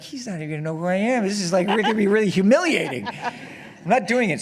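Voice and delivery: embarrassed younger self refusing, laughing; laughing protest. Retold or imitated. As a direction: protest while cracking up. Retelling his own embarrassed protest.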